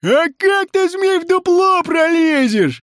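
very high, taunting shout; mocking heckle; snide jeer. A narrator's reading. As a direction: needle him with a loud question. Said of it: "не удержался от ехидства Емеля Пугачёв".

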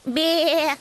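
imitation of a bleating sheep; sheep's bleat. A narrator's reading. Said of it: "ответила та".

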